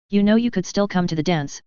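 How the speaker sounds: ordinary delivery, nothing marked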